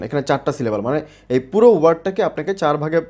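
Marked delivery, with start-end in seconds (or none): none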